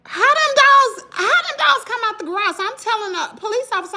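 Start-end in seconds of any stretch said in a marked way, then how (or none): 0.0-2.6 incredulous. Retold or imitated. Working out who let the dogs out, high and incredulous.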